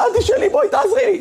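pitched very high, panicked and pleading; a doctor drowning in the chaos; frantic plea. Retold or imitated. Rafi begs the nurse for help in high panic.

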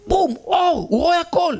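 high-pitched burst of wonder; high, astonished exclamation; amazed outburst. Acted. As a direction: burst out as if light floods in. Sudden sight, amazed outburst.

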